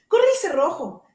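high, loud call from the bed; the old woman calls through the door; grandmother calling out. Acted. Grandmother calls to the visitor to slide the bolt, high and loud.

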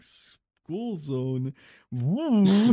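loud whooping bro; frat bro whoop. Retold or imitated. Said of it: "you'd be the kind of person that'd be like".

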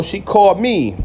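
usual speaking voice

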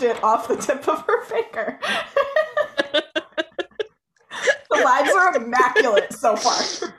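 words breaking into laughter; laughing. Real, not acted. Laughing at the shell mishap.

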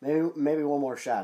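ordinary talk, nothing marked

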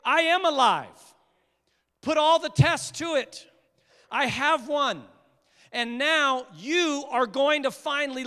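loud, high, triumphant proclamation; triumphant Jesus. Retold or imitated. Jesus telling the disciples he has won, triumphant. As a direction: declare victory with full force. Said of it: "Jesus is showing them".

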